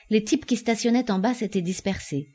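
ordinary delivery

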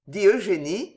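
ordinary delivery

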